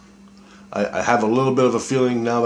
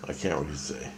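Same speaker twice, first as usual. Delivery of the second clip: trailing off, much softer; softer repeat; 5 semitones lower, 9 dB quieter than usual. Real, not acted. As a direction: say it again to yourself, dropping away.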